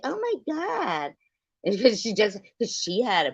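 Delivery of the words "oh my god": high, slow oh my God; mock shocked gasp. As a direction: play up the shock. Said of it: "she's like".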